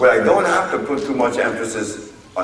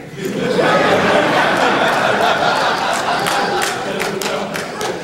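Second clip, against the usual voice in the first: long breathy high-pitched laughter. Real, not acted. Laughing at the doctor's claim in court, high and breathy.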